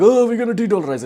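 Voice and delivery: high, loud mocking squeal of a boastful nation; mock a nation's big claim in a high, loud voice. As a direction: squeak it out like a silly braggart. Mocking de-dollarization talk.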